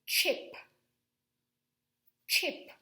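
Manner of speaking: chip said loud with a crisp ch; the ch of chip punched out; saying chip. Shown as a demonstration. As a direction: sound out chip clearly for the class. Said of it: "if I were to say the word".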